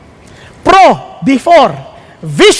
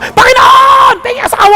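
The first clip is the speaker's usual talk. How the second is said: shouted prayer; 10 semitones higher than usual. Retold or imitated. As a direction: yell the prayer boldly up to heaven. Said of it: "asawa lang, sinisigaw pa".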